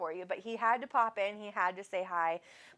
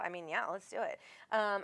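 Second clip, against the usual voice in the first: ordinary talk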